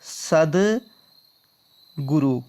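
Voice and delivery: सदगुरु stretched out syllable by syllable; very slow correct form. Shown as a demonstration. Teacher very slowly dictates सदगुरु to the class. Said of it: "क्या होता है?".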